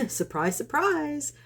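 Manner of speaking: high, drawn-up mock surprise; sarcastic surprise. Real, not acted. Vlogger reveals who was behind the dog, sarcastic mock surprise. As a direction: pitch the second word way up, wryly.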